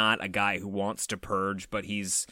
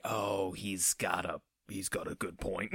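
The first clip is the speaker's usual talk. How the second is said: slow, soft grudging agreement; lower, quieter, slowly conceding; a quiet, slow aside from the audience; mock audience murmur; 5 semitones lower, 10 dB quieter, slower than usual. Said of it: "audiences would say".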